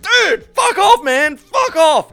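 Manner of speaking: shouting at the nosy neighbor to go away; angry shout. Retold or imitated. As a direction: blast it out at the pushy neighbor. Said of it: "It's like".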